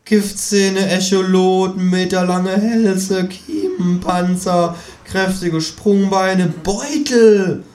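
high-pitched kangaroo voice; musing kangaroo. A narrator's reading. Kangaroo musing on nature's gifts, high lecturing voice. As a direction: marvel aloud in a high voice.

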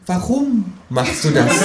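high, slow, patient kangaroo. Acted. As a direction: rephrase it slowly as the smug kangaroo. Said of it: "sagt das Känguru". (hohe, langsame, geduldige Känguru-Stimme)

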